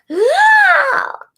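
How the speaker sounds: loud, high dramatic gasp; mock gasp. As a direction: gasp in hammed-up shock. Mock shock at the nominations.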